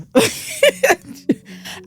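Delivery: sudden high burst of laughter. Real, not acted. Laughing at her own mix-up, a high burst of laughter.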